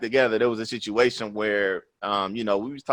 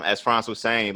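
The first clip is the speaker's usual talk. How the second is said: usual speaking voice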